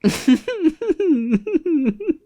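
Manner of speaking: high-pitched giggle fit; uncontrolled laughing. Real, not acted. Gamer after announcing a momentous occasion, cracking up. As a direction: dissolve into high, helpless giggles. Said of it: "I don't know why I'm laughing".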